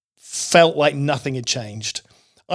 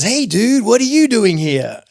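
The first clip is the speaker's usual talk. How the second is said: higher, laid-back surfer dude calling out; 6 semitones higher, 3 dB louder than usual. Retold or imitated. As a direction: greet him like a friendly aging surfer. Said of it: "And he says".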